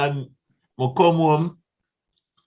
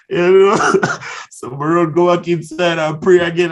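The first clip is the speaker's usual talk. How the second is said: a louder retelling, higher and loud through the laughter; laughing while telling; 6 semitones higher, 6 dB louder than usual. Real, not acted. Singer recalling his lucky break, laughing aloud. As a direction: laugh through the words as the memory tickles you.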